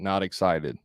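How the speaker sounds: "excited" falling at the end as a statement; "Not excited" agreed to, no questioning lift; confirming drop-off. Shown as a demonstration. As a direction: close "Not excited" down like a full stop. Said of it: "That's, like, confirming".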